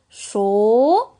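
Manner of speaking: CHAUD as a long "cho", loud and rising; blended sounds. Shown as a demonstration. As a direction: draw out the vowel of "chaud". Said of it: "Ch avec O".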